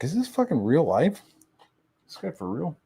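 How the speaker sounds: mock disbelief. Disbelief at the guy's story.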